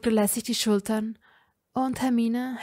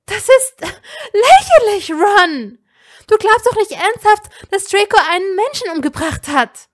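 much higher, loud indignant cry; raised voice; 11 semitones higher, 13 dB louder than usual. A narrator's reading. Hermine defending Draco against Ron, loud and indignant. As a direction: throw it at him in outrage. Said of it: "Hermine erhob ihre Stimme".

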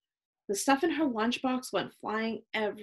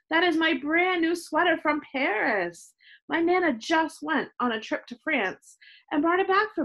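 high, whiny dismay; upset protest; 7 semitones higher, 4 dB louder than usual. A narrator's reading. Chloe upset over her new sweater, dismayed. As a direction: lament it with pitched-up distress.

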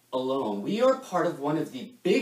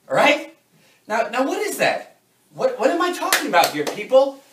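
loud, high-pitched team rallying; fired-up pep talk; 6 semitones higher, 6 dB louder than usual. Acted. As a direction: pump up the sales team with pointed questions. Firing up a sales team.

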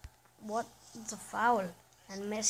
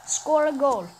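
loud, higher bossy order; mock command; 5 semitones higher, 12 dB louder than usual. Playing Simon says to his striker, loud mock order. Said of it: "Simon says".

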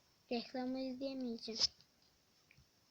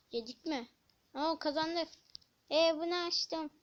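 ordinary delivery

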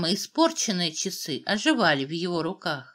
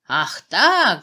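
loud outraged yell; acted angry cry; 8 dB louder than usual. A narrator's reading. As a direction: burst out in royal outrage. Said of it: "завопил Клясер".

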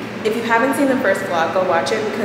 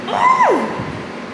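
startled squeal. Real, not acted. Sudden cry on balcony.

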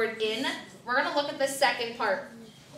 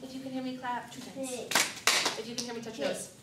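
quick, softer sing-song attention call; classroom call-out; 2 semitones higher, 4 dB quieter than usual. Acted. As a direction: rattle off the hear-me commands to the kids. Attention-getter call and response.

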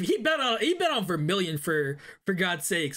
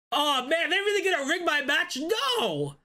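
can't believe what he just read; shocked disbelief; 6 semitones higher than usual. Real, not acted. Shocked at rigged match.

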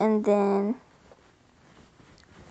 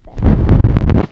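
BESTIES yelled out, airy and loud; excited shout of Besties; 13 dB louder than usual. Pretend. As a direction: blast the word Besties. Repeats besties loudly.

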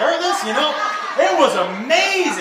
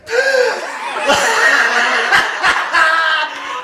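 high, frantic gasps; gasping for air; 5 semitones higher than usual. Retold or imitated. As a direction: come up gasping as if from underwater. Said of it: "his head would pop up like this".

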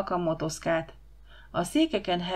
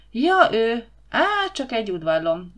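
dismissive; 4 semitones higher, 6 dB louder than usual. A narrator's reading. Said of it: "legyintett méltóság teljesen".

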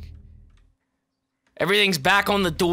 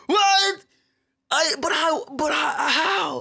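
mock crying; 12 semitones higher, much slower than usual. Fake crying after dying.

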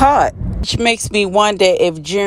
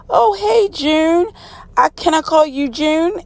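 high, sing-song mock friendly greeting; lightly voiced smarmy captor, pitched way up; sly Fred greeting; 9 semitones higher than usual. Fred greets June in cell.

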